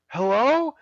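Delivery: high questioning hello; calling out. Retold or imitated. Hiker calls into the cave again, high and questioning.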